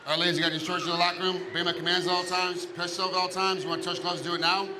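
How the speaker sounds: fast referee instructions. Real, not acted.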